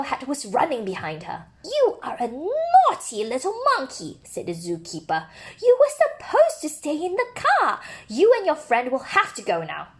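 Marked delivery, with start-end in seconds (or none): 1.6-4.2 higher, mock-stern telling-off; higher zookeeper voice, mildly cross; scolding zookeeper. 5.6-9.9 higher, mock-stern telling-off; higher zookeeper voice, mildly cross; scolding zookeeper.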